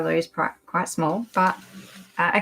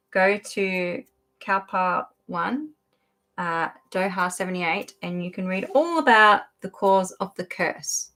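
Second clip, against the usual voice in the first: ordinary delivery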